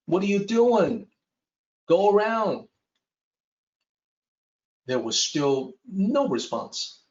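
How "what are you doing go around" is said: higher, restrained mock shout; narrated louder yell, mild. A narrator's reading. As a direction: call out in alarm, lightly acted. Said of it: "at a louder volume".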